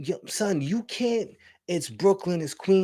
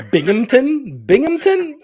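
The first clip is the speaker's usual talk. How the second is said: rising disbelief, louder each time; baffled repeat of a town name; incredulous; 8 semitones higher, 9 dB louder than usual. Real, not acted. Friend mocking an upstate town, incredulous.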